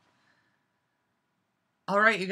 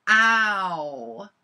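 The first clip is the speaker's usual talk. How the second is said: loud, higher mock yelp; loud drawn-out imitation yelp; imitated "ow"; 4 semitones higher, 8 dB louder than usual. Imitating the guy after he licks the muffin, mock yelp. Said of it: "and he's like".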